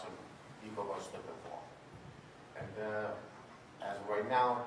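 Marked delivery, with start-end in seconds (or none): none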